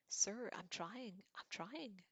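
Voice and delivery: high, drawn-out pleading; desperate. A narrator's reading. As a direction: plead helplessly, stretching the words. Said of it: "said Harry desperately".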